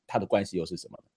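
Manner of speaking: ordinary talk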